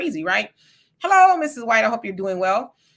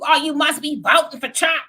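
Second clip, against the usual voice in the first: higher, louder mocking voice of critics; 6 semitones higher, 5 dB louder than usual. Host mocking people who assume his vote, higher and louder. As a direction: sneer it like a smug know-it-all. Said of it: "they automatically go to".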